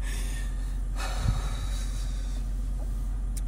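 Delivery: acted long, breathy exhale; out-of-breath puffing. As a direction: mimic being winded between takes. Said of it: "I was like".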